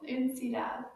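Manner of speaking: normal talking voice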